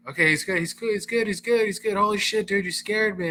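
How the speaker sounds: relieved friend. Retold or imitated. Friend relieved after scare.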